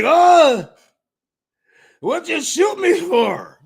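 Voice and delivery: high, slow, indignant mock complaint; a high, drawn-out whine of mock outrage; mock outraged whine. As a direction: play the wounded fool who got shot. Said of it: "I'd be like".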